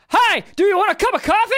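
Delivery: high, loud bit-part actor; hammed-up, far too eager delivery; overacted one line. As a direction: oversell a tiny movie line. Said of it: "someone overacts their one line".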